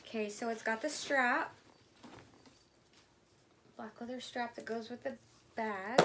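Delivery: ordinary delivery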